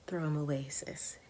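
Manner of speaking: hushed, near-whispered advice; quiet aside. Real, not acted. Advising a sis to drop a stingy guy, quiet aside. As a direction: say it low, under your breath. Low first take, then emphatic repeat.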